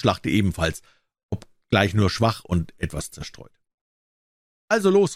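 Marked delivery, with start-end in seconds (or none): 4.7-5.2 high, impatient royal command; impatient call. A narrator's reading. Impatient king presses his jester for ideas, called out high. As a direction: urge him on, pitch jumping up. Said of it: "rief der König ungeduldig".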